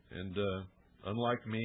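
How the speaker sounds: normal talking voice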